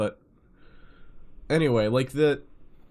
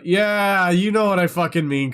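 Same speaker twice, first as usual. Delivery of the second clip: higher and louder, enthusiastic yes; excited agreement; 7 semitones higher, 7 dB louder than usual. Real, not acted. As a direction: agree loudly, fired up. Excited agreement about soda.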